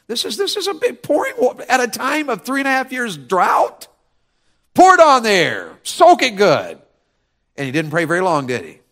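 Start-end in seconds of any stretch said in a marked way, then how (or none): 0.1-3.9 high-pitched, sputtering disbelief; incredulous. Acted. Onlooker at water poured during drought, incredulous. 4.7-6.8 loud, high, emphatic command; called-out order. Acted. Elijah orders water onto the altar, loud command.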